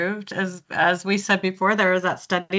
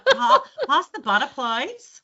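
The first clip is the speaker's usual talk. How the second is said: broad put-on Australian accent; Kath and Kim Australian; 7 semitones higher, slower than usual. Shown as a demonstration. Coach told she sounds like the show, broad Australian accent. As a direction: overdo the Australian vowels. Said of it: "watched a lot of Kath".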